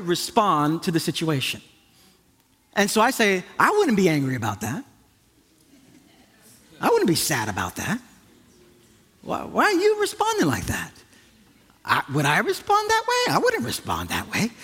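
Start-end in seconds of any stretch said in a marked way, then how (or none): 3.6-8.0 mock incredulity at someone's feelings; judgmental disbelief. Acts out his unempathetic responses to wife. 9.2-14.5 exaggerated incredulous puzzling; mock bafflement. Acts out judging his wife's reaction.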